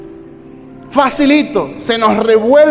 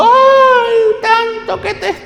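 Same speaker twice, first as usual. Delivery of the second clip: over-the-top fake mourning; mock wailing mourner; 6 semitones higher than usual. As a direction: ham up a crying widow's lament. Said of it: "vamos a andar allí como zangaletones, llorando".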